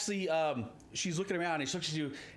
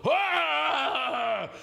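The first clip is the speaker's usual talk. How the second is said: loud, high war cry; monster battle roar; 10 semitones higher, 7 dB louder than usual. Acted. Two-headed ettin roaring at the party before swinging. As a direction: let out a huge roar.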